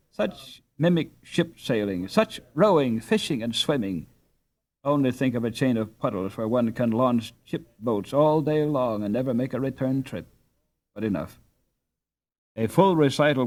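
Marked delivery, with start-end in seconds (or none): none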